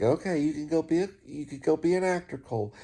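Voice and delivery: a hint of a patient mother brushing him off; mom's weary reply. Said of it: "And she's like".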